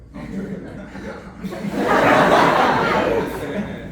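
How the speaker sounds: loud burst of laughter. Real, not acted. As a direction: laugh big into the mic. Comic laughing into mic.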